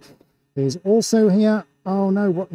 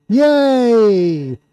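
drawn-out, louder "yay"; long loud cheer; 5 semitones higher, 4 dB louder than usual. Real, not acted. Host sees camera working, long happy cheer.

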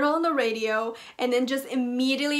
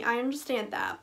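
usual speaking voice

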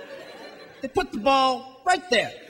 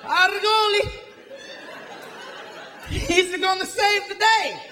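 higher, excited put-on foreign fan voice; unplaced European accent, building to a shout of triumph; mock soccer fan accent; 3 semitones higher than usual. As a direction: cheer like a hopeful fanatic in a vague accent. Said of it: "This is the sound of soccer fans".

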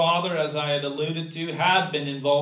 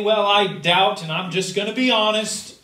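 defiant candor; 4 semitones higher, 8 dB louder than usual. Pretend. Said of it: "He doesn't say".

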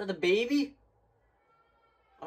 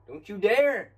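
"Don't you dare!" yelled, much higher and louder, stress on "dare"; panicked warning; 6 semitones higher, 7 dB louder, slower than usual. Real, not acted. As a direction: shout it at the screen in fright. Scared player warning the game.

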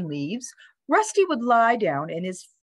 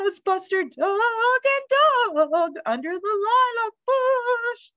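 high, warbling storyteller bird; bird reading aloud; 14 semitones higher, slower than usual. A narrator's reading. Bird reads dog story to Rusty, high warbling. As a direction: sing out the story high, quavering the vowels. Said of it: "the bird read".

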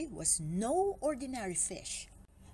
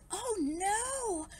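high, drawn-out cry of dismay; alarmed cry; 8 semitones higher, 3 dB louder than usual. A narrator's reading. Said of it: "cried Barry".

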